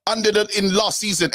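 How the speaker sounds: high-pitched, indignant accusation; heated retort. Real, not acted. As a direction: push back hard, voice pitched well up.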